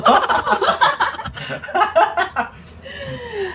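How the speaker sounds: high laugh. Real, not acted. Laughs after own joke.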